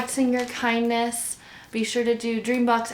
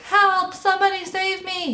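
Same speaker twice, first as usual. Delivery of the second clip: very high, panicked cry; shouting for help; 12 semitones higher, 4 dB louder than usual. A narrator's reading. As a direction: call out desperately to anyone who can hear. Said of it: "he shouted".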